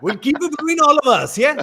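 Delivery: high laughing conspiracy. Real, not acted. Host laughing while promising the audience keeps the secret.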